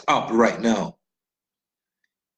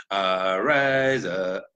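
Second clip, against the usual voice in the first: fumbling start; 4 semitones lower, much slower than usual. Retold or imitated. Politician fumbling the pledge.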